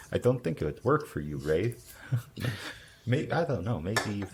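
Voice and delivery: ordinary delivery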